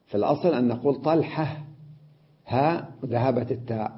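ordinary delivery

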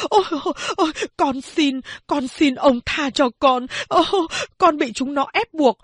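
giọng cao, cuống quýt cầu xin; quỳ lạy xin tha mạng; van xin sợ hãi. Acted. (giọng van lơn hoảng sợ)

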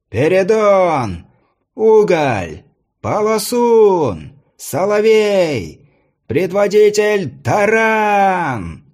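high, slow, drawn-out announcer call; herald calling out. A narrator's reading. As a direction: stretch each name out across the arena. Herald announces fighters in arena.

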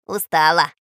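low, loud parrot grumble; grumbling. Acted. Parrot Zhako remarks on the dozing girl, grumbling. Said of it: "проворчал Жако".

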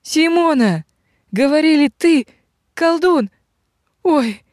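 high-pitched overwrought exclamations; close to tears. A narrator's reading. Robber woman finding her friend, near tears. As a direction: break it into short, high bursts. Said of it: "Она была готова расплакаться".

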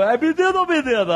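mock smooth crooning call; Léo Lopes velvety voice. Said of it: "você pode ter essa voz aveludada".

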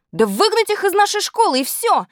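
put-on very high-pitched girl's outcry; indignant outcry. A narrator's reading. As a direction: demand it in a high, outraged cry. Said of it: "Но отдельные выкрики".

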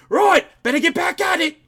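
high, shouted mock-British voice; British accent. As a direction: holler back as a British bloke. Plays the British actor calling back.

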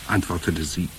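plain reading voice

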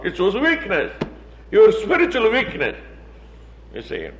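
ordinary delivery

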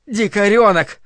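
loud, high outburst; angry insult. A narrator's reading. Mother tears his hand from his face, angry outburst. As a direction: hurl the name at the child.

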